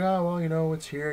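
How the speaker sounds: lightly hammed-up seller voice, luring him back; coaxing salesman.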